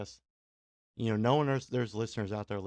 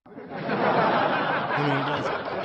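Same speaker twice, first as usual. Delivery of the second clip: laughing through the words; 6 dB louder, much slower than usual. Real, not acted. To listeners about his back, laughing. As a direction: laugh it off as he says it.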